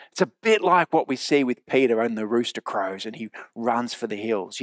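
ordinary talk

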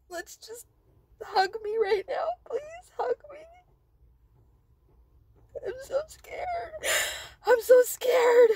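high, loud sobbing; sobbing plea. Real, not acted. Vlogger begging her friends for a hug, sobbing. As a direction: beg for a hug through tears. Pleading through tears.

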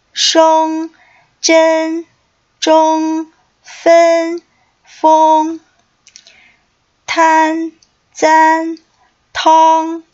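high, loud, evenly paced drill reading; pronunciation drill. Shown as a demonstration. Teacher leading students through practice syllables, a loud measured drill call. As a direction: call each syllable out clearly for students to repeat.

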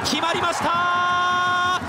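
excited goal call. Real, not acted.

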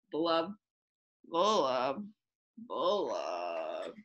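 deep mournful blub sinking low; sad low blub. A narrator's reading. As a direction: let the blub drop into a sigh. Sad fish bubbling.

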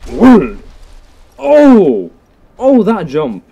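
loud, high, excited whoops; exhilarated shout, pitch jumping up; amazed exclamation. Real, not acted. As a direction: cry out in awe at a huge leap. Amazed by a big jump.

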